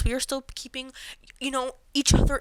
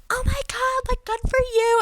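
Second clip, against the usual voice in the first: excited friends; 4 dB louder than usual. Retold or imitated.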